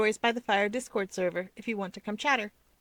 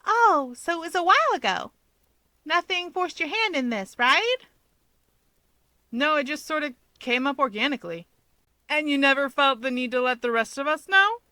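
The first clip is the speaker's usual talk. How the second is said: higher, lightly voiced young woman; light female character voice; 7 semitones higher, 5 dB louder than usual. A narrator's reading. Rika calmly questions Hawks about the compromise, lightly voiced. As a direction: voice Rika, calm and probing. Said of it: "Calmly, Rika remarks".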